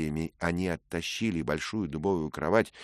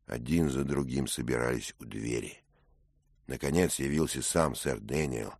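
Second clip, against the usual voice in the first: usual speaking voice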